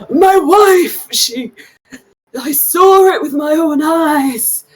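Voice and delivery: high, breaking voice of a frantic halfling; panicked, near tears, pleading; distraught halfling. Acted.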